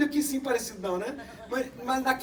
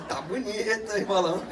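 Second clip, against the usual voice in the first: lower, laughing tease at the mirror; laughing self-flattery; 5 semitones lower than usual. Retold or imitated. Preacher joking to his mirror reflection, laughing. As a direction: chuckle and compliment yourself. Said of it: "falo assim".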